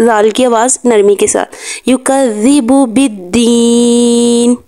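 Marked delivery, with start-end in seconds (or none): 1.9-4.5 slow melodic tajweed chant; Quranic recitation. Shown as a demonstration. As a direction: recite slowly with full tajweed. (آہستہ تجوید کے ساتھ تلاوت)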